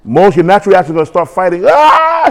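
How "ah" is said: high-pitched imitation scream; brief high "ah" of mock panic. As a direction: imitate someone screaming, not at full volume.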